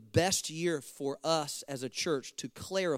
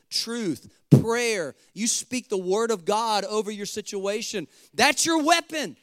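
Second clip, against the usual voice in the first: high, driving sermon delivery building louder; fired-up preaching; 7 semitones higher, 7 dB louder than usual. Real, not acted. Pastor urges church to take their families by force, preaching forcefully. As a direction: preach it at full force, hammering each command.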